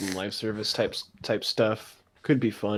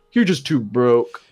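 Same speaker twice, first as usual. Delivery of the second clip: smug taunt; 7 dB louder, slower than usual. Retold or imitated. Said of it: "look at you dead in the eyes ... and say".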